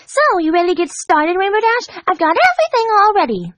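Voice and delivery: high, bouncy and thrilled; bubbly Pinkie voice. A narrator's reading. Pink pony greeting her friend, high and bouncy. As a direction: squeal it like an overexcited pink pony.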